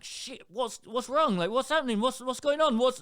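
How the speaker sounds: high, loud alarmed questions; a parent suddenly frightened; retold panic; panicked parent. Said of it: "I'm like".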